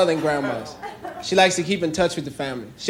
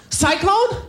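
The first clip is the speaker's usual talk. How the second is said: high, eager family voice; excited theme park visitor; 11 semitones higher, 3 dB louder than usual. Retold or imitated. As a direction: call out to Grandma about the ride. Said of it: "families walking around the park going".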